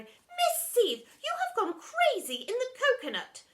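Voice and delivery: secretary protesting to the deputy head. Acted. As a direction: cry out against the madness.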